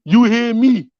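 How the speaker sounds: put-on loud Baltimore street accent. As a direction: hit it hard in a Baltimore accent. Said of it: "my Baltimore accent".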